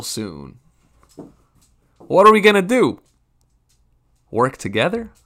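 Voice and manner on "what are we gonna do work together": exasperated outburst. Real, not acted. Ranting about empty invites.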